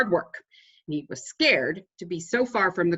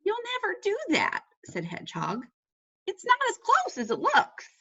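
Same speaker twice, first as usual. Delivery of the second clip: much higher, know-it-all hedgehog; 13 semitones higher than usual. A narrator's reading. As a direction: squeak it out as the hedgehog. Hedgehog's voice, high.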